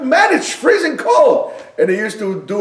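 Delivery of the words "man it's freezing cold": high loud shout, slowed; loud high slow wake-up call; father's wake-up shout. Retold or imitated. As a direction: bellow to get the kids up. Father waking kids at six.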